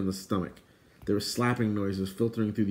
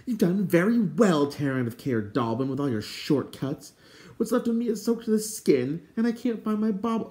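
high-pitched indignant scolding; piqued, high-voiced telling-off; 9 semitones higher than usual. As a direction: complain crossly in a high character voice. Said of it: "suddenly vocal and indignant".

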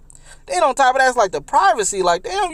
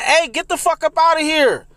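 high, raised mock yell to get out; telling her off; 7 semitones higher than usual. On the toilet, ordering the girl out with a mock yell. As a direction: chase her off the bathroom, half shouting. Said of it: "I'm like".

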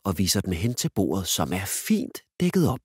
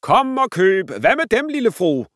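shouting; 13 semitones higher, 7 dB louder than usual. Acted. Dad teasingly selling his daughter, loud market cry. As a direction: hawk it like a market trader. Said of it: "råber han til en dame".

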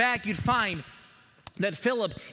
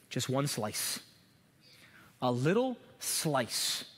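much softer and lower, slowing on "a little slice"; hushed; 8 semitones lower, 5 dB quieter, slower than usual. Acted. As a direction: drop the voice to draw them in. Preacher drops to hushed emphasis.

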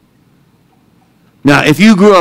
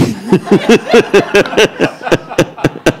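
breathy laugh; 6 semitones higher than usual. Real, not acted. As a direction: laugh it off under your breath. Laughing about trip cost.

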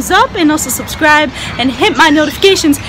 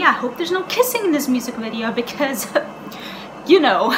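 ordinary talk

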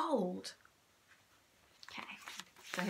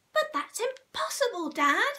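put-on very high child's protest; small monkey protesting; 12 semitones higher than usual. A narrator's reading. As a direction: object to dad. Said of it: "cried one of the small monkeys".

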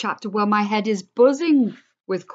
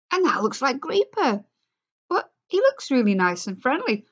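higher, wondering voice; surprised; 7 semitones higher than usual. Acted. George studies the photo, wondering. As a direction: marvel at the young face.

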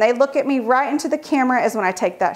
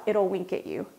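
softer conspiratorial aside; hushed secret to a child; secretive hush; 5 semitones lower, 10 dB quieter than usual. Retold or imitated. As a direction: let the kid in on a secret, softly. Telling kid a secret.

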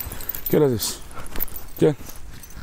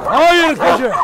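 commanding loud shouts, much higher; sert bağırış; 13 semitones higher, 4 dB louder, much slower than usual. Real, not acted. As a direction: yell firm commands to stop the fighting dogs. Köpekleri yeniden durdurmaya bağırıyor. (köpeklere sert, yüksek sesle bağırarak emir veriyor)

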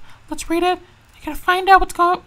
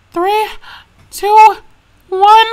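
rising, louder countdown; tense countdown; 4 semitones higher, 8 dB louder, much slower than usual. Acted. [name] counting down before opening results, tense. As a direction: count down bracing yourself.

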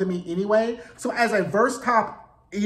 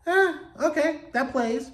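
higher, amused acceptance; mild surprised shrug; 7 semitones higher than usual. Retold or imitated. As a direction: shrug it off, surprised but fine. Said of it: "I was like".

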